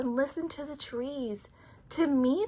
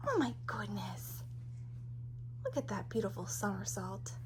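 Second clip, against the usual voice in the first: hushed, amazed whisper; awed whisper; 7 semitones lower, 11 dB quieter than usual. Real, not acted. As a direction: whisper in wonder. Whispered aside while reading.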